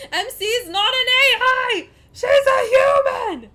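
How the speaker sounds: very high, loud, drawn-out yell; exasperated screaming. Real, not acted. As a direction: scream it as a desperate protest. Yelling in exasperation about AI.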